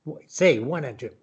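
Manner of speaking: ordinary delivery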